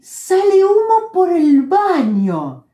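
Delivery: slow, high, urgent report; mock alarm at the smoke; stretched alarmed words. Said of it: "¿Qué pasó?".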